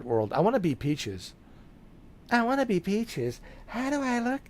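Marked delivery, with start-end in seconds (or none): 2.3-4.4 much higher and softer, dainty; girly princess voice. Pretend. Streamer pretending to be Peach, in a high soft girly voice. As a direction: coo it like a princess.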